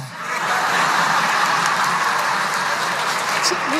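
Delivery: a high airy burst of real laughter; high breathy laugh. Real, not acted. Preacher tickled by the fisherman's words, laughing high.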